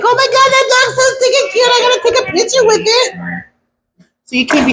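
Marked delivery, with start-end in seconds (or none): 0.0-3.1 much higher, squealing over something cute; high gushing excitement, fast at the end; gushing over a cute dog. Retold or imitated. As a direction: coo like you just saw the cutest thing. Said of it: "you're like".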